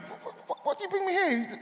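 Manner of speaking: high, furious outburst after the sermon; steaming. Retold or imitated. Angry at his girlfriend after church, a high indignant outburst. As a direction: blurt it out fuming, half laughing.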